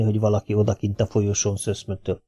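plain reading voice

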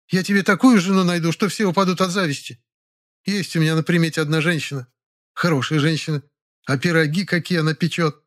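high-pitched, unforced female character voice; matchmaking woman. A narrator's reading. Neighbour promises the rejected man a wife, coaxing. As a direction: coax him in a high, lighter voice.

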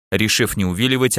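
ordinary delivery, nothing marked

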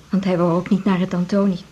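ordinary talk, nothing marked

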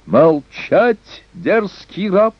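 high, drawn-out furious command; angry cry. A narrator's reading. Эрнак silencing a defiant vassal, angry cry. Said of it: "закричал Эрнак".

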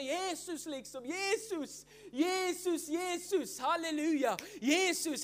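high, insistent calls to Jesus; imitated crying out. Preacher acting blind Bartimeus calling for Jesus, imitated crying out. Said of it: "bare skrike høyere".

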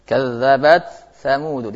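"Kadzabat Tsamudu" said slowly with the t clearly sounded; izhar pronunciation. Shown as a demonstration. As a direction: keep the t of "Kadzabat" distinct before "Tsamudu". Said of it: "Dengan izhar di situ".

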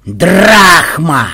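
loud, put-on offended growl from the smoke; offended monster voice. A narrator's reading. The boatman demanding payment from the smoke, loud and offended. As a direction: demand the coin, wounded and loud. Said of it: "обиженный голос".

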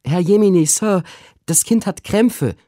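high, loud alarmed cry; the maid in a fright over the child; shrill high alarm at the baby's fits; panicked maid. A narrator's reading. Maid panics about the baby.

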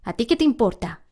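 violent retort. A narrator's reading. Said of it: "le increpó Grieve violentamente".

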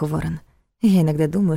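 usual speaking voice

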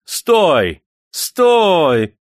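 high, loud, stretched calls; urgent shout. A narrator's reading. Master runs after the departing lad, shouting. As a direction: holler after someone walking off.

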